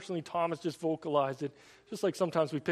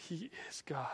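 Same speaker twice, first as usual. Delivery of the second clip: a hushed, breathy whisper for emphasis; 11 dB quieter than usual. Real, not acted. Preacher to congregation, an intense whisper. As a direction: drop to a whisper to land the point. Key claim of the sermon.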